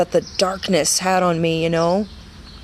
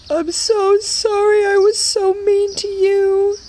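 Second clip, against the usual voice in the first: much higher, slow, voice close to breaking; tearful apology; 4 dB louder, slower than usual. Retold or imitated. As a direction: plead sorry with tears in the voice.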